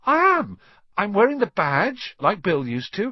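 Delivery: very high, loud excitement; bursting with pride; excited. A narrator's reading. Ron boasts in wonder.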